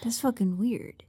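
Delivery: hushed voiceless aside; whisper. Retold or imitated. Zoro mutters to himself in a whisper. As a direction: murmur it under your breath.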